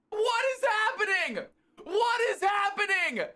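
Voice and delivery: high, laughing shout of disbelief; elated disbelief. Real, not acted. Streamer overwhelmed by a big gift, laughing shout. Reacting to huge donation.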